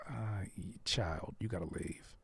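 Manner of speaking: soft near-whisper shooing someone off; barely voiced, brushing them away; muttered child you gotta leave; hushed dismissal. Quiet mock dismissal.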